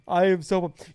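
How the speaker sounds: ordinary talk, nothing marked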